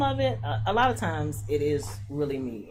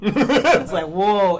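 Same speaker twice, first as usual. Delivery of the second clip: laughing; 4 semitones higher, 13 dB louder, much slower than usual. Retold or imitated. Laughing reaction to the singer.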